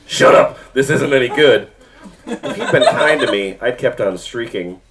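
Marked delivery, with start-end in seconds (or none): 0.1-1.7 harsh. Acted. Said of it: "he said harshly".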